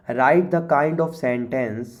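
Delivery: slow, deliberate reading of the task; slow read-aloud. Shown as a demonstration. Teacher reading the worksheet instruction slowly to students. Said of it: "reading the task aloud slowly".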